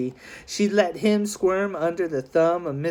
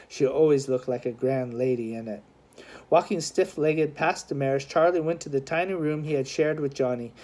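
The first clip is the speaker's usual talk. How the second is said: usual speaking voice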